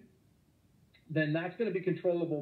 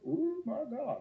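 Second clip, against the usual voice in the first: mock awe; 10 semitones higher, slower than usual. Mock awe at big hump.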